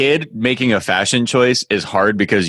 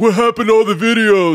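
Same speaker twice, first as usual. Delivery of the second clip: high-pitched mock outrage; hammed-up dismay, pitched way up; whining complainer; 12 semitones higher, 3 dB louder than usual. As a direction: imitate a bewildered user wailing a complaint. Mimics complaining site users.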